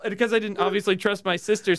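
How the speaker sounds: ordinary delivery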